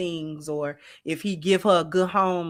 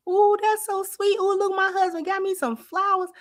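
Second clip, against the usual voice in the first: very high gushing "ooh" over the gift; gushing grateful wife; 12 semitones higher than usual. Pretend. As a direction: coo like a delighted wife over flowers. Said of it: "We gonna have that same reaction".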